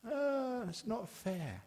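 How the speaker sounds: a high, whining "it's not fair"; whiny lament. Retold or imitated. Monk imitating mourners protesting the young deaths, whiny. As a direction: mimic the grieving public's complaint.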